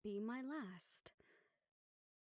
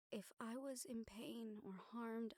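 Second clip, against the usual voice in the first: normal talking voice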